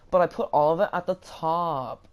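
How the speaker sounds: loud mock protest, leaning on the last word; insistent protest. Counter girl arguing with her manager, insistent.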